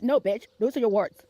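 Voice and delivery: usual speaking voice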